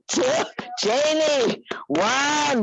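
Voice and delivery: high, cheering count; excited countdown. Real, not acted. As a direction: push them through the last counts. Teacher counting down, cheering class.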